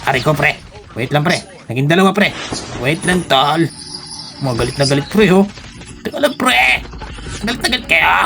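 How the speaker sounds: high, hammed-up panic; mock pleading. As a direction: beg attackers in playful alarm. Feigned pleading while being shot.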